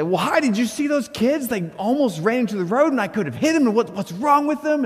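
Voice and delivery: high, frantic ranting; going off angrily. Retold or imitated. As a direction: re-enact a dad's indignant outburst to his daughter. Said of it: "I just kinda go off again".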